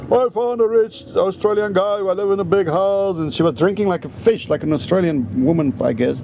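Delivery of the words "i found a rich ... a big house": mock boast. Mimicking the ex-wife bragging about her new husband, lightly voiced. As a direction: show off the rich new life.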